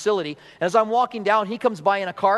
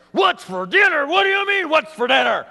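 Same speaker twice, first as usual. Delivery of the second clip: higher, louder, exasperated retort; irritated snap; 8 semitones higher, 4 dB louder than usual. Acted. Wife snaps back at husband asking about dinner, louder and higher. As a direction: fire the question back at him, annoyed.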